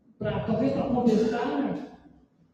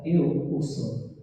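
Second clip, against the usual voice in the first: very slow, weighty declaration; revelação solene; 3 semitones lower, much slower than usual. Acted. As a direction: reveal it slowly, word by word. Jesus revela-se à samaritana. (declaração solene e pausada)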